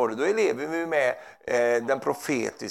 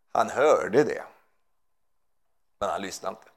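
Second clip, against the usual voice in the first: normal talking voice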